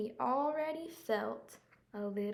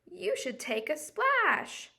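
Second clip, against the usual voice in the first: playful advice; 7 semitones higher, 5 dB louder, slower than usual. Acted. Hippo advice, voiced.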